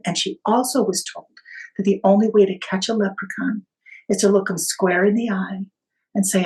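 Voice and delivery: ordinary talk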